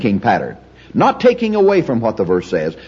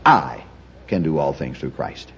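"I" set apart, the rest much lower and softer; stress on I; 9 semitones lower, 7 dB quieter than usual. Shown as a demonstration. Teacher shows the class how to emphasize each word, stress on I. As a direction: hit "I", pause, then drop away. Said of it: "I would first emphasize".

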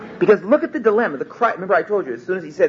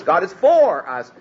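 for stressed, slower; 3 semitones higher, slower than usual. Shown as a demonstration. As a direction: press on the word for. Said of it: "putting the emphasis on four different words".

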